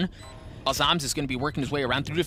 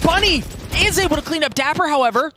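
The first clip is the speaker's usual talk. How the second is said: high loud excited call; esports caster; 12 semitones higher, 6 dB louder than usual. Real, not acted. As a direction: shout the play as it happens.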